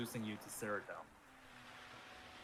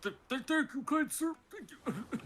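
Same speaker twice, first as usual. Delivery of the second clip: muffled; 11 semitones higher than usual. Acted. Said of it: "You hear him muffled voice".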